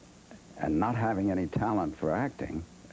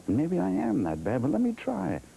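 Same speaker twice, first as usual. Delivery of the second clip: retold defiance; 11 semitones higher than usual. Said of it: "I got angrier and angrier".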